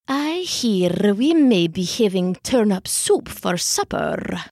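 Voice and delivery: low, purring cat voice. A narrator's reading. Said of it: "she purred".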